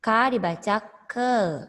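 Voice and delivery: usual speaking voice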